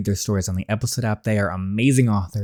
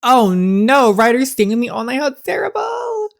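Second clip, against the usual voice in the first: high, hammed-up fake dismay; sarcastic mock horror; 13 semitones higher, 5 dB louder than usual. Girl pretending to be upset the boss stays, hammed-up sarcasm. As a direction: fake a gasp of horror, clearly delighted.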